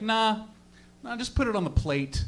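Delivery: offhand retold reply, unhurried; casual brush-off. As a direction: toss it off like it's nothing.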